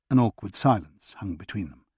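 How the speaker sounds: ordinary delivery, nothing marked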